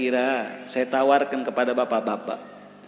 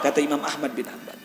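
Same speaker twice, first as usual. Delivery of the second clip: usual speaking voice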